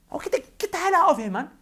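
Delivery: high, loud dismissive burst; exasperated outburst. Real, not acted. Telling critics to leave, exasperated. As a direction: send them packing, pitched high.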